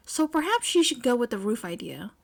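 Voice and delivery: ordinary delivery, nothing marked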